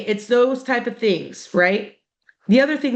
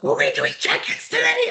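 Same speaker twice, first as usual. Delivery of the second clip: much higher, bursting with eagerness; overeager student. Retold or imitated. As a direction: imitate a kid who can't wait to talk. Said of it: "bring it down a notch".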